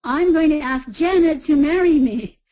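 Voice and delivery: full projection right to the end of the sentence; projected to back wall. Retold or imitated. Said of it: "And he said".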